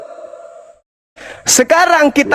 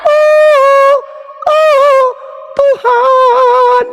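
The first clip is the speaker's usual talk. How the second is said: mock wail; 13 semitones higher, much slower than usual. Mocking the opponent with a high, hammed-up cry to God. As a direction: wail "Tuhan" in a hammed-up falsetto.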